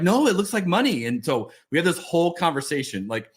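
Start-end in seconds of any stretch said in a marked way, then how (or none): 0.0-1.0 higher-pitched imitation of a child insisting; insistent daughter. Daughter argues with dad over the fake bill, mock insistence. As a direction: lightly mimic a kid protesting to dad.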